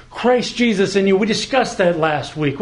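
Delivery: ordinary talk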